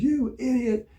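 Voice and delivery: very high, loud mock scream; retold screaming. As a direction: imitate the chief screaming an insult. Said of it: "he started screaming at me".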